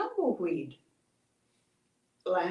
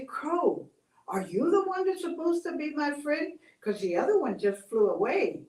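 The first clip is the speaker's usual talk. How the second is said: high, pleading voice of a lonely cactus; a lonely cactus pleading, a touch tearful; lonely crying cactus; 6 semitones higher, 4 dB louder than usual. Acted. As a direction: beg for a friend, close to tears. Cactus asks Crow.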